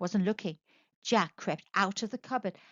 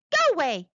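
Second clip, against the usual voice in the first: loud, higher shoo; shooing Jack away; 5 semitones higher, 6 dB louder than usual. A narrator's reading. Giant's wife turns Jack away, loud. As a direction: send the boy off sharply. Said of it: "she told him".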